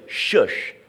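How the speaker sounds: breathy shush, no voice; whispered shush. Retold or imitated. Preacher showing how Jesus hushed the storm, whispered. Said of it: "you and I might say".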